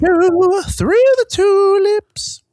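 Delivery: very high, slow quavery falsetto. Pretend. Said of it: "like it was [name]".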